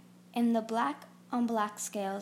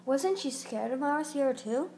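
usual speaking voice

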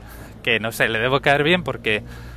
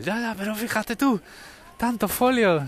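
mock clerk voice; 9 semitones higher than usual. Clerk complains about paper pile.

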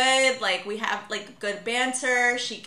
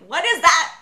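higher, loud retort; mock indignation; 5 semitones higher, 9 dB louder than usual. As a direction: snap back louder, as a playful retold comeback. Acted reply to remarks on age.

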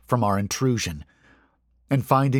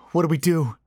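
higher, mildly frightened question; slightly shaking voice; 7 semitones higher, faster than usual. A narrator's reading. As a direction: ask with a touch of a tremble. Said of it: "his voice shaking slightly".

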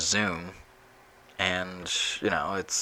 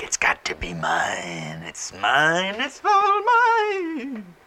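greedy caveman; 10 semitones higher, much slower than usual. Retold or imitated. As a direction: whisper rising to a high, drawn-out wail. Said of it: "caveman mentality of".

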